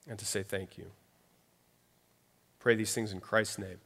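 closing prayer. Acted. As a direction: pray quietly and humbly for the congregation.

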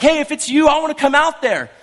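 high, loud shout across the water; calling out. Acted. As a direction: call out eagerly from a distance. Peter calling out to Jesus.

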